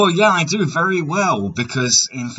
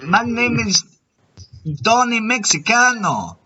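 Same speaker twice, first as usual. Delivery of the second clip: higher, hammed-up Mexican accent; 6 semitones higher than usual. Prank caller giving a fake name in a higher, hammed-up Mexican accent. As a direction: pitch the Mexican accent up and ham it. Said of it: "My Mexican accent is all over the place".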